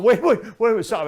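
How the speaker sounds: high, loud repeated wait fading at stop; frantic yell. Retold or imitated. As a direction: flag down the car in a panic. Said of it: "and I'm going".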